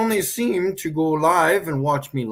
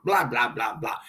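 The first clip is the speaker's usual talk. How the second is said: mocking; 6 semitones lower, 4 dB quieter than usual. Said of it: "I like to hear myself talk".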